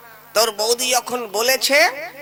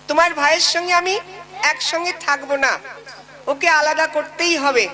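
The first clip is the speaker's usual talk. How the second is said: higher, quick nagging wife voice; a comic bride's higher, fast complaint; 5 semitones higher than usual. Acted. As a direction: play the demanding wife laying down the law. Wife repeats demand.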